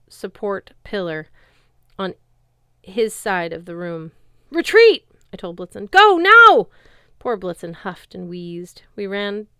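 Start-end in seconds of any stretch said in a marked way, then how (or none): 4.5-5.1 loud, high urgent order; urgent command. A narrator's reading. Commanding Blitzen to flee. 5.9-6.7 loud, high order to run; urgent command. A narrator's reading. Commanding Blitzen to flee.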